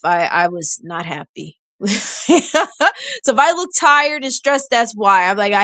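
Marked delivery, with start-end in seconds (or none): 1.8-3.2 high laughing burst; rueful laugh. Real, not acted. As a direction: laugh at your own outburst. Laughing after admitting the outburst.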